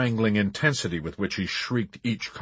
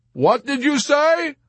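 high, raised-voice question; oblivious calling out; 6 dB louder than usual. A narrator's reading. Arfi to exhausted Yossarian, oblivious loud question. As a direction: call out as if unable to hear. Arfi asks, not hearing.

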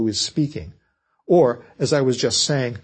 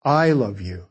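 "I" leaned on, then "love you" falling away; emphatic "I" at the start; stress on I; 3 semitones lower, slower than usual. Shown as a demonstration. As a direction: put the weight on the word "I". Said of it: "to show the speaker's emphasis".

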